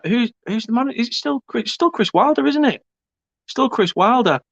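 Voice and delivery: high, unsure voice; puzzled high questioning. Real, not acted. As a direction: ask it high and baffled.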